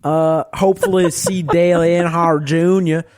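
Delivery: put-on Southern US accent. Shown as a demonstration. Podcaster doing requested impression for co-hosts, broad Southern accent. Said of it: "the Southern accent?".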